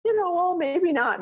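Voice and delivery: high, light reconsidering; musing aloud. Retold or imitated. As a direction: wonder aloud, easing off. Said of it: "I might say".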